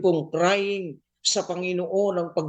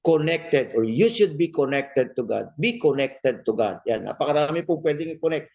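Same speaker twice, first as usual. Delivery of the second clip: ordinary talk, nothing marked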